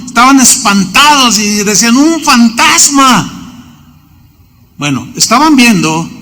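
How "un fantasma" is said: slow, high cry of fright; frightened cry. Acted. Disciples crying out at the figure in the storm, frightened cry.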